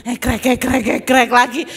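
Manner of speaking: loud imitated krek krek of a blade cutting; cutter crunch sounds. Telling the congregation about cutting, loud imitated blade crunches.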